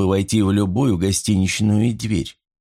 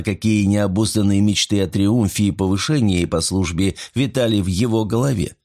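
plain reading voice

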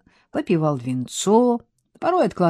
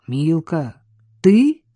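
hoarse question; 4 dB louder, slower than usual. A narrator's reading. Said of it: "прохрипел он".

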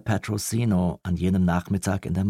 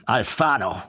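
thundering call; 3 semitones higher than usual. A narrator's reading. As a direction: bellow the name across the room. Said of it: "mit donnernder Stimme".

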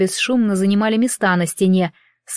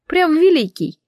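raised, mocking disbelief; sarcastic; 8 semitones higher than usual. A narrator's reading. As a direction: scoff at the grandeur.

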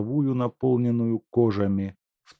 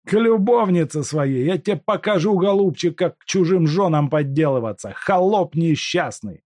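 furious taunt; 10 semitones higher, 6 dB louder than usual. Acted. Куликов издевается, в ярости.

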